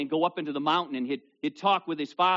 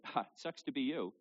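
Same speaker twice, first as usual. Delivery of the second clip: dismissive sympathy; 8 dB quieter than usual. Retold or imitated. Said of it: "Sympathy says".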